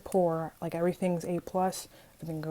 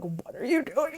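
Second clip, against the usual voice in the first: high, squealing mock panic; flustered squeal; 8 semitones higher than usual. Girl reacting to crush smiling at her, flustered squeal. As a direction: let the question shoot up in giddy disbelief.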